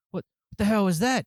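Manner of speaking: loud startled shout, high; alarmed shout. Acted. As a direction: yell it out in alarm. NPC shouts at booming noise.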